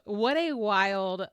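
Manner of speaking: ordinary talk, nothing marked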